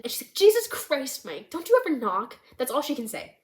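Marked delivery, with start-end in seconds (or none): 0.3-2.4 high, louder startled protest; acted startled scolding, pitched up; frightened outburst. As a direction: snap in fright at someone barging in. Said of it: "she's frightened".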